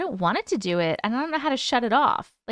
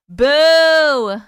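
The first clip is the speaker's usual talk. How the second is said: mock boo; 3 semitones higher, 9 dB louder than usual. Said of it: "Just joking".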